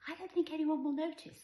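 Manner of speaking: put-on high, light mother-to-child voice; reassuring mum. A narrator's reading. Mother answering her daughter's worry about school, reassuring.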